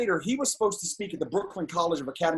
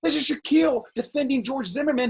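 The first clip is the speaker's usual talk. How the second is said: ordinary delivery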